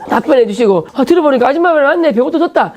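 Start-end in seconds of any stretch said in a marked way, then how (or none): 1.5-2.7 high, gleeful jeering; teasing jeer. Retold or imitated. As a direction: rub it in like kids. Kids teasing him.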